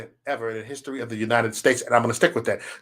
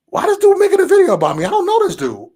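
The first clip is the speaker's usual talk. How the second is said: high-pitched, fast indignant bewilderment; retold baffled outrage; 14 semitones higher, 5 dB louder than usual. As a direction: blurt it out in high disbelief. Retells his baffled first reaction.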